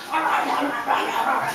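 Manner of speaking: growly munching, like a hungry animal wolfing food; greedy gobbling noises. Acted. Storyteller imitates the hungry tiger at the cat's food, greedy gobbling.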